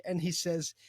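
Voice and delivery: ordinary talk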